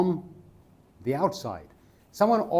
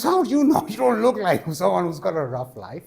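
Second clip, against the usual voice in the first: high, loud, indignant challenge; hammed-up disbelief, starting high and easing down; challenging vendor; 6 semitones higher, 5 dB louder than usual. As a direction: act out an affronted retort. Acted quarrel, vendor challenges him.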